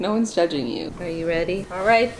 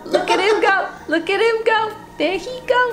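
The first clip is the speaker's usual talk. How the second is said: sing-song chant climbing higher each time; building cheer; 7 semitones higher than usual. Real, not acted. Owner cheers the pet on as he goes, building excitement. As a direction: egg him on, pitch rising steadily.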